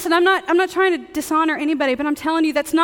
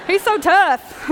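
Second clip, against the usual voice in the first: slow, higher-pitched mock Southern drawl; Southern accent; 5 semitones higher, 5 dB louder, slower than usual. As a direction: imitate an encouraging guy with a stretched Southern accent. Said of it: "I don't know why he has a Southern accent".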